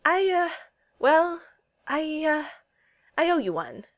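high, halting, flustered start; stammering. A narrator's reading. Steve admits to Peggy he owes her, stammering. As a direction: stumble through it with awkward pauses. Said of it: "Steve stammered".

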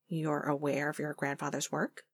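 soft, low, guarded question; careful question. A narrator's reading. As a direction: ask it carefully and quietly, feeling out the ground. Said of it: "Phil asked carefully".

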